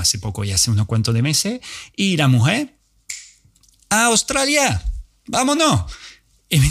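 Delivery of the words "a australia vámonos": put-on much higher, eager old lady; eager friend. Elderly friend urging a trip to Australia, much higher and eager.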